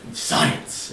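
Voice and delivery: one word squeezed through laughter; laughing. Real, not acted. Joking to friend about unknown references, laughing. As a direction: laugh it out as a joke.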